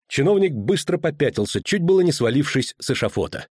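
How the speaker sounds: usual speaking voice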